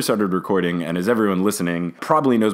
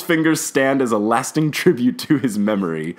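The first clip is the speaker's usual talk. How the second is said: sarcastic; 3 semitones higher than usual. Retold or imitated. Host imitating Fred and George teasing Ron, sarcastic. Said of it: "Fred and George sarcastically say".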